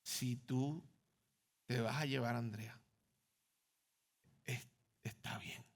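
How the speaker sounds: soft, broken whisper of surrender; tearful hushed prayer. Retold or imitated. Father crying in the bathroom to God, soft tearful whisper. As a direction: barely whisper it, as if weeping. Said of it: "empecé a llorar y a llorar".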